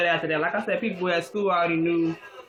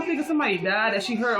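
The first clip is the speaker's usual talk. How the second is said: usual speaking voice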